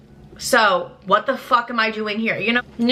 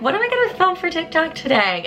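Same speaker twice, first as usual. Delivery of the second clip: airy inner voice; 7 semitones higher than usual. Pretend. Voicing her inner self.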